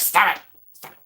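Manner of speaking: mock panicked protest. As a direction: blurt it out in mock alarm. Acts out scared reply to hag.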